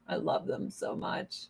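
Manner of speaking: soft, breathy aside; whispered. Real, not acted. Whispering to viewers about loving her cats. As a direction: whisper it like a secret.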